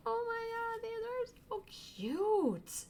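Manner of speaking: high, slow cooing squeal; high delighted coo. Real, not acted. Squealing over cute stickers.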